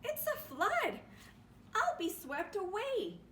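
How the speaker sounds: high, loud cry of alarm; frightened at the rising water. Acted. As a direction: gasp it out in sudden fright. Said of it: "he cried".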